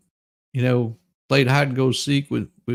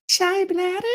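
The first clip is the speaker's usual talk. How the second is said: mock shy voice. Joking aside on bathroom.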